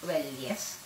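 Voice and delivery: ordinary talk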